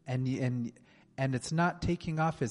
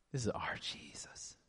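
whisper; 3 semitones lower, 10 dB quieter than usual. Real, not acted. Awed reverent aside.

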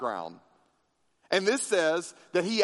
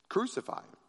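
"Crucify him" voiced and higher, a mild cry; crowd crying out; 5 semitones higher, 6 dB quieter, faster than usual. A narrator's reading. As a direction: lift "Crucify him" into a restrained shout. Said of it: "And in a loud voice, the crowd cried out".

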